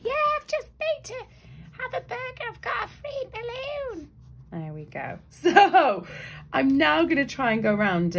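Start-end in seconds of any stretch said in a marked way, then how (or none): 0.0-4.1 put-on high, chirpy child voice; cheeky kid. Kid in the back seat bragging, high chirpy child voice. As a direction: chirp it like a pleased little boy. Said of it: "he's whacking the mum".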